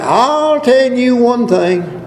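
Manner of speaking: high, slow emphatic line; emphatic preaching. Acted. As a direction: lift it up and slow it down. Preacher rises to emphatic point.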